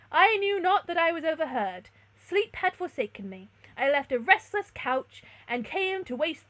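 earnest storybook suitor; courteous stranger. A narrator's reading. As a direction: apologise in formal declamation. Stranger answers the princess.

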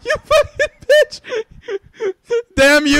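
high, loud shrieking through laughter; laughing scream. Real, not acted. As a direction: scream curses while cracking up. Cursing the game, laughing.

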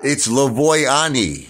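slow, careful "Lavoy Ani" with a lifted pitch; proper Italian pronunciation. Shown as a demonstration. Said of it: "It's not Lavoie".

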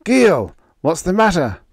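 high, loud worried call. Acted. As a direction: shout down the passage, concerned. Captain calling for crying Gil.

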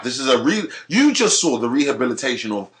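ordinary talk, nothing marked

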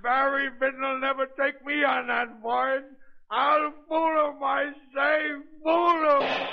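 screaming defiance through tears; tearful shout. Acted. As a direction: hysterical sobbing shout. Man shouting as he raises the gun.

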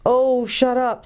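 mock impatience, drawn out; impatient. A narrator's reading. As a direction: snap it to stop his moaning. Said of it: "said Jill impatiently".

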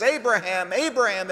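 lightly acted high, urgent call; a hint of a heavenly summons. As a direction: call out the name twice, pitched high. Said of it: "the voice says".

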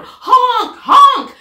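loud, high honking horn imitation; blaring car horns. Speeding cars honking at the ducks, loud honk. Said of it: "went the horns on the speeding cars".

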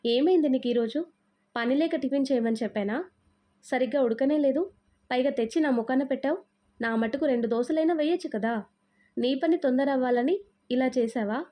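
plain reading voice

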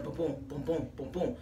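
low, soft thudding heartbeat sounds; heartbeat boom. Retold or imitated. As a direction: thump it out like a racing heart. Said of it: "My heart is just, like, pounding".

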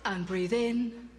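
low, very slow, hushed instruction; mock calming masseuse telling a client to breathe in; soothing therapist voice. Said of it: "they go".

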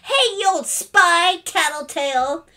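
high, loud drawn-out jeer; loud kid's name-calling; shouted taunt. A narrator's reading. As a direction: shout it at your sister. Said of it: "Philip shouted".

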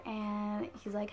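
ordinary delivery

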